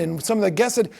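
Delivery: normal talking voice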